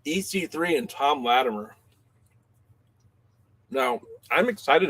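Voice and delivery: talking with a mouthful; chewing between words; mouth full. Real, not acted. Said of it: "with a mouthful".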